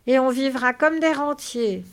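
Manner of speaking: loud, high, delighted exclamation; bubbling happiness. Acted. Lénie chimes in on the dream, bubbling with happiness. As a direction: exclaim it overjoyed. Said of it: "Lénie gloussait de bonheur".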